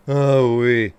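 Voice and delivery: loud, relieved "Oh, wee"; relieved whoop. Real, not acted. Relief after stopping accent.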